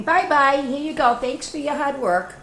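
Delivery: high, loud cheery farewell voice; farmer's goodbye. Acted. Farmer cheerfully sends the pigs away, high and bright. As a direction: wave them off brightly.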